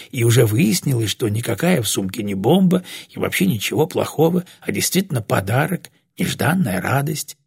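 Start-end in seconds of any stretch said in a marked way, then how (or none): none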